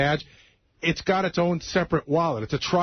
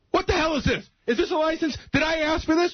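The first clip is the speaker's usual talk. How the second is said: much higher, indignant retold outburst; angry cop; 11 semitones higher than usual. Traffic cop grilling a driver over the card, angry. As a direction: imitate an outraged cop firing off questions. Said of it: "very angry. He goes".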